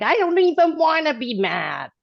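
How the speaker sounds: replaying her own frustration, voice up high; exasperated self-quote. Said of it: "And I'm like".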